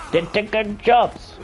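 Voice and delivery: high, slow cartoon American South Park accent. To viewers, mocking in a South Park American accent. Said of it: "put on a South Park accent".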